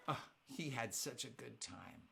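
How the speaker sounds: ordinary talk, nothing marked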